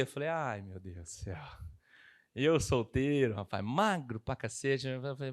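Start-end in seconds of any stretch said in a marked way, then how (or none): 0.3-1.4 dismayed sigh. Retold or imitated. Dismayed at lunch invite. 2.3-4.9 slow recall. Retold or imitated. Embarrassed young man recalling.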